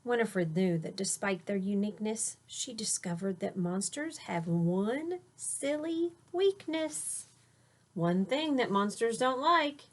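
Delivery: usual speaking voice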